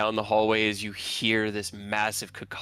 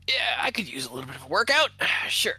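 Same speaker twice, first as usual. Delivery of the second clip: character voice; 3 semitones higher, 4 dB louder, faster than usual. Acted. GM voices Fina agreeing.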